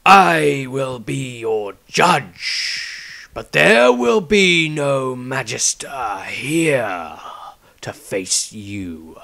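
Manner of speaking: very slow, grave elder's voice; the patriarch challenging Maestro, slow and grave; stern old patriarch. Acted. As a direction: weigh every word like a judge. Patriarch speaks slowly.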